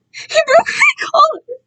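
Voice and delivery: high, slow sobbing wail; crying wail. Acted. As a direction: wail it through tears. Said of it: "Kai cried".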